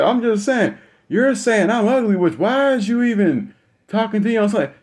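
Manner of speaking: imitating a protesting son, very high-pitched; high-pitched, aggrieved self-defence; indignant protest. Son arguing back at his insulting mother, protesting.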